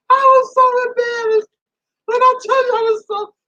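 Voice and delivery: high, squealing laugh through the words; cracking up at your own embarrassment. Real, not acted. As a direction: barely get it out for laughing. Laughs over her own embarrassment.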